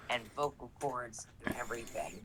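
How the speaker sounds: breathy unvoiced whisper trailing off. Real, not acted. Player whispers the gory detail to the game master. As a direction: drop to a secret hush on the gory detail.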